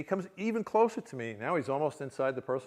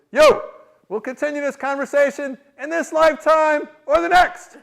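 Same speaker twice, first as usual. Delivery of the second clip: high, very loud proclamation; acted-out gleeful challenge; booming call-back; 10 semitones higher, 16 dB louder than usual. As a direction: holler it across the crowd. Lama calls back across room.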